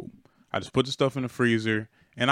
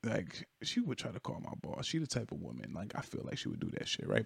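soft unvoiced thinking aloud; inner thought; 12 dB quieter than usual. Driver in the truck, quietly worrying the lady will call his boss. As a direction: hush it like a worried thought to yourself. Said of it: "So I'm like".

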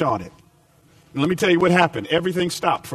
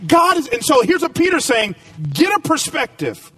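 rising preacher's push, building through each line; preaching; 6 semitones higher, 4 dB louder than usual. Acted. Pastor preaching to his church, emphatic and driving. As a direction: preach it out to the congregation with conviction.

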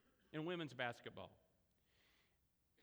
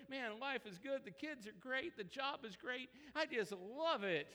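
acted elation; 7 semitones higher than usual. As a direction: play someone thrilled with life. Acting out life going great.

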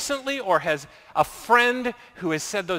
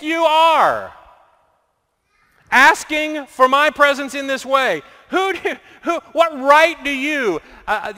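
higher, louder scolding rebuke; scolding God; 5 semitones higher, 4 dB louder than usual. Acted. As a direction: tower over him like a scolding God. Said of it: "God comes scoldingly".

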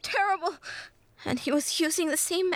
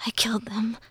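breathless whisper, full of dread; terrified whisper; 2 semitones higher, faster than usual. A narrator's reading. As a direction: whisper it to yourself in horror. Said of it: "voice dripped with terror as he whispered".

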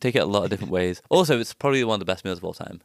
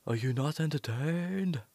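slow, hushed, higher-pitched film quote; Gladiator in character; 5 semitones higher, 7 dB quieter, slower than usual. Acted. Host breaking into a meditation with a film quote, in character. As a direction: draw the line out softly, playing the gladiator. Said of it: "trying to get into character".

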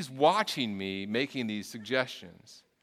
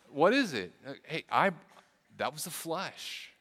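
normal talking voice

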